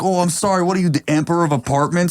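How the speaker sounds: high-pitched sarcastic New York Italian; mock New Yorker shouting up at a window; New York Italian dude. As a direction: imitate a street heckler, mock-outraged. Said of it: "New York Italian dude, like, yelling".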